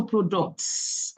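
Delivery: PRODUCTS with a long hissed S at the end; drawn-out final S. Real, not acted. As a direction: stretch the plural ending of "products". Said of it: "emphasis on the products, the S".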